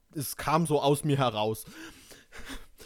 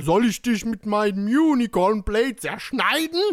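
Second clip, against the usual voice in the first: loud threat climbing in pitch; straight threatening take; 6 semitones higher, 9 dB louder than usual. Acted. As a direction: say it straight through, rising to a shout. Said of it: "lassen wir jetzt mal".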